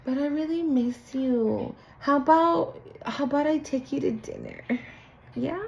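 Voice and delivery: high, soft coaxing invitation; sweet-talking, hushed and needy; mock pleading ex. Ex begging to take you to dinner, soft coaxing. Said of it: "throw in the little".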